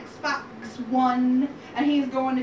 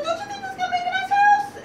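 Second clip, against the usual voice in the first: very high, louder piglet squeal; excited little pig; 5 dB louder than usual. Acted. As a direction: eagerly pitch it to your brothers in a piglet voice. First pig urges his brothers.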